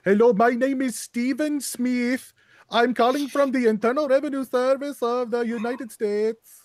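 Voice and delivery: imitating a scam caller reading his script; mock scammer. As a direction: play a fake IRS agent, stiffly. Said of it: "he's like".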